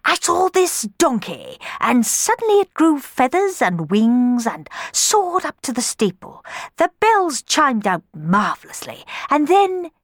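grand, swelling storyteller voice; pompous father. Acted. Don Magnifico telling daughters his dream, pompous. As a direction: boast about the dream.

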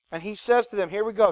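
normal talking voice

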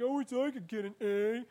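lightly voiced lazy student wishing; a hint of a slacker's whine; mock deadbeat student. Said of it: "some of you deadbeats are like".

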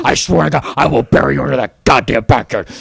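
unhinged shouted tirade; angry rant. Acted. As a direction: rage at her until it cracks into screams. Acted Mel Gibson rant, screaming.

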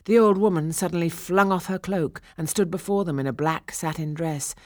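ordinary delivery, nothing marked

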